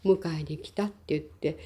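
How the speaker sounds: usual speaking voice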